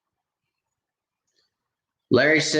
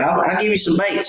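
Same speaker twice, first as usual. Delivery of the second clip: higher, eager coaxing; coaxing offer; 9 semitones higher than usual. Retold or imitated. Man urging a timid boy to take baits, high and eager. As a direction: coax the shy boy into taking them.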